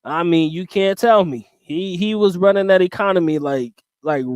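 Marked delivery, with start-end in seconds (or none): none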